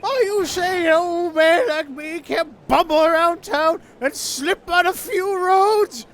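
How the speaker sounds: put-on a juror's outraged old man voice; cranky old man. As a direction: rant like an offended old man in a courtroom. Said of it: "that's my typical old man accent".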